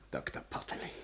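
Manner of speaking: whispered, barely voiced; hushed whisper. Scientist answers the broken husband, hushed whisper. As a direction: whisper it coldly.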